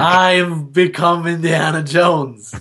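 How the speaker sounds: slow grand hero voice; Indiana Jones. Pretend. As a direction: declare it like a movie hero. Dramatic declaration after archaeology joke.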